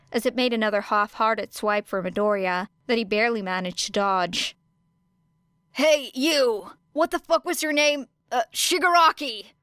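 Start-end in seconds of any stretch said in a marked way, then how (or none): 5.7-9.5 called out. A narrator's reading. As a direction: shout across the battlefield to provoke. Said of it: "called Shinso from behind Era".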